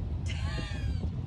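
breathy laugh at the bumps; high breathy laugh. Real, not acted.

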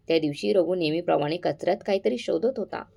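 ordinary delivery, nothing marked

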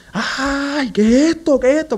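high-pitched retold cry of panic; imitando gritos. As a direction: re-enact crying out, pitch jumping way up. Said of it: "a gritar". (grito agudo de desesperación, imitado)

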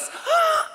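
sharp high gasp of surprise; imitating Macaulay Culkin's gasp; startled gasp. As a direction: gasp like a kid who just got scared. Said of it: "you remember that movie when he goes".

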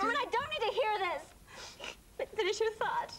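high, quick, tearful objection; tearful protest. Acted. Upset woman to the man comforting her, tearful. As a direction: protest high, then ask through tears. Upset, then wants the compliment.

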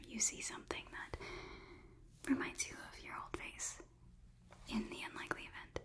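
soft, quick whisper; quick, barely-there whisper; whispered ASMR aside. Pretend. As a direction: let it sink into a hushed whisper. ASMR role-play, drops to whisper.

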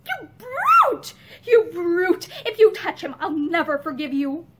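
loud, overwrought fury; passionate outburst. A narrator's reading. Overwrought mother cursing her husband, passionately. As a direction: start high and loud, then ease into a threat. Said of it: "Pansy broke into passionate sobbing".

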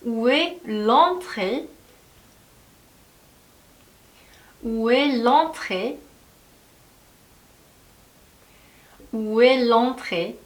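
very slow, syllable by syllable; slow drill. Shown as a demonstration. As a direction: model it slowly for repeating. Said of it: "La entrée 인데 모음과 모음이 만나서 축약이". (따라 하도록 아주 천천히)

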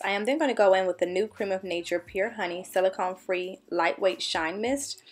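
ordinary talk

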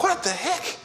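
high, squeaky astonished question; can't believe what he's hearing; baffled. Retold or imitated. Scholar stunned by her reply, high astonished question.

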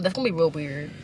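ordinary delivery

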